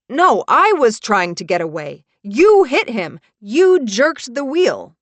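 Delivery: loud, high, outraged sputter. Acted. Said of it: "Brecken sputters".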